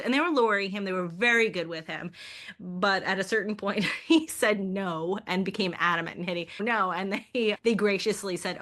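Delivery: ordinary talk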